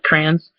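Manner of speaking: "Crayons" as one syllable, CRANS; Michigan pronunciation. Shown as a demonstration. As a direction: squash Crayons to rhyme with fans. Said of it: "I'm always like".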